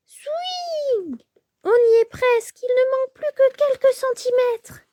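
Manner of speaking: almost out, the mouse cheers; excited mouse. Acted. (souris aiguë, pleine d'espoir)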